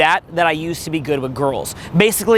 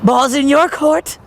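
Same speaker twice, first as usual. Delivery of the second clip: high, slow and forced; put-on uptight guy voice; strained forced delivery; 9 semitones higher, 3 dB louder, slower than usual. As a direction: push it out high and awkwardly. Mimics guy faking uninhibited move.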